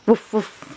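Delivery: two short, puffed woofs; playful dog noises. Shown as a demonstration. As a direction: bark like a dog for a toddler. Parent imitates dog for child.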